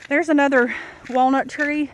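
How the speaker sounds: usual speaking voice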